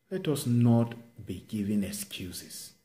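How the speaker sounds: ordinary delivery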